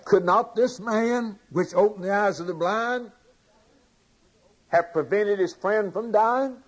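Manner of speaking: high-pitched, rising challenge; imitated doubters, measured and incredulous; questioning doubters. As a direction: voice the skeptics' pointed question, pitched well up. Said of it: "began to question his love".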